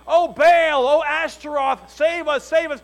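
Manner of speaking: loud, high mock plea to Baal; desperate cry to idols. Preacher voicing captives begging false gods, loud mock plea. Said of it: "you don't say".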